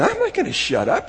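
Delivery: high-pitched defiant protest at normal volume; mock yelling. Bartimaeus refusing to be hushed, mock yelling. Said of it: "He's yelling top of his lungs".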